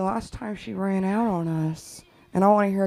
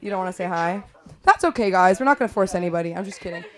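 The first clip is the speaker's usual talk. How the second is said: normal talking voice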